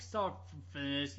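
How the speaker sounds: ordinary delivery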